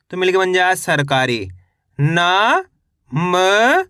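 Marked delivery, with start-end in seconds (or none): 2.0-3.8 each syllable held long, separated by pauses. Shown as a demonstration. Teacher sounding out a word for reading learners, syllable by syllable. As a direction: sound out each letter slowly, stretching it. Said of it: "एक एक अक्षर का उच्चारण".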